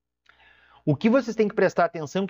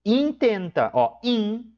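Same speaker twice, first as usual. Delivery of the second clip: intenta with the in raised and stressed; stressed syllable demo; 6 semitones higher, slower than usual. Shown as a demonstration. Teacher isolating the tonic syllable for students, raised. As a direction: lift and hit the in of intenta. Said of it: "sílaba tônica".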